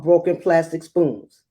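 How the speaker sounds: plain reading voice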